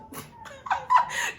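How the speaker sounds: squealing high laugh; high burst of laughter. Real, not acted. Laughs at her own thought.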